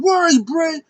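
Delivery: very high, loud searching cry; imitated squeaky shout for someone; mock frantic call. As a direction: call out in hammed-up high panic. Acts out calling for Bray.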